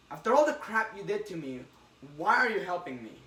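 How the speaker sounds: loud, indignant question; angry demand. Retold or imitated. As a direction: confront him angrily. Peter confronts his old enemy.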